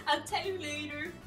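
ordinary talk, nothing marked

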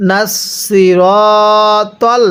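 very slow নাসিরতল with long rounded "ro"; model recitation. Shown as a demonstration. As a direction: stretch the middle of নাসিরতল on a level tone. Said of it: "আমার সাথে আপনি পড়ুন".

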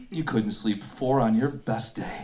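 low, slow, quiet put-down; talking down. Acted. Camper trash-talks his tent as psychological warfare, low and condescending. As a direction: belittle it in a low, measured voice. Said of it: "Talk down to it".

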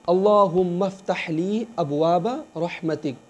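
slow, devout recitation of the Arabic; dua recitation. Acted. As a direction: recite the prayer reverently. (अदब से दुआ की तिलावत)

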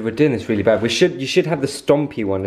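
ordinary talk, nothing marked

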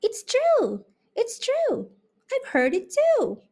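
very high squeaky mice greeting; tiny mice. A narrator's reading. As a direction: chirp it like two tiny mice. Mice insist.

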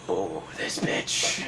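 very low, breathy mutter to himself; slow, hushed, low grumble; muttered insult. Retold or imitated. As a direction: drag it out under your breath. Muttered inner reaction to coworker.

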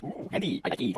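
whisper. Real, not acted. Sneaking quietly.

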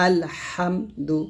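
আলহামদু slowly, ha pressed out from mid-throat; slow syllable-by-syllable আলহামদু with a throaty হা; deep throaty ha. Shown as a demonstration. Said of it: "হলকের মাঝখান থেকে চাপ দিয়ে".

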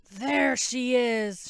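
loud shout from a rough-voiced pursuer. A narrator's reading. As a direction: call out roughly, spotting your quarry. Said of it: "shouted a rough voice".

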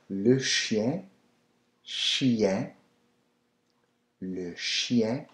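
chien with a nasal French ending. Shown as a demonstration. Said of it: "The I E N is always yen".

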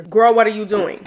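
loud disbelieving call-out; mock-scolding the viewer, loud and punchy; loud exclaim. Real, not acted. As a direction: shout it at a friend who's missing out. Scolding viewers who skip product.

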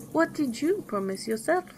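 high, mildly reproachful sorrow; sad princess. A narrator's reading. Princess turns the question back on her mother, mildly sad. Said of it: "replied Bellissima sadly".

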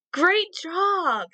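great job, bright and high; cheerful praise. Real, not acted. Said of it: "praising children".

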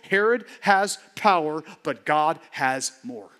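normal talking voice

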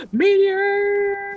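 high startled cry; gasp, then shout in alarm; excited shout. Real, not acted. Spots a falling meteor.

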